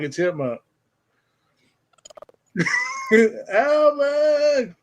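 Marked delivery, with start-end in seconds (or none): 2.5-3.5 squealing burst of laughter; real, helpless high laugh; high shrieking laugh. Real, not acted. Laughing at chipmunk-sounding audio. 3.5-4.7 laughing shout. Real, not acted. Teasing the chipmunk-sounding caller.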